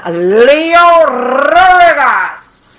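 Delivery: drawn-out call. Real, not acted. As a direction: stretch the name out like an announcer. Host calls out a name.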